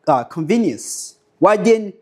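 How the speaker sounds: ordinary delivery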